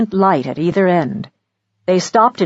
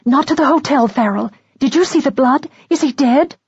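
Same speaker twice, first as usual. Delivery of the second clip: frightened; 6 semitones higher than usual. Acted. Frightened woman after the stabbing.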